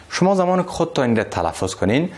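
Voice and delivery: ordinary talk, nothing marked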